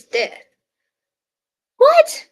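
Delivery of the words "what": sudden high loud shocked what. Real, not acted. Reacting to the paintball news, a shocked loud what. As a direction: blurt it out in disbelief.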